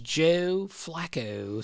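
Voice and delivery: slow, stretched Baltimore long O's; Baltimore accent. Retold or imitated. Steve Kerr doing the on-air gag, Baltimore accent. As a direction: imitate the exaggerated Baltimore O. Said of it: "Steve Kerr doing S V P on the air".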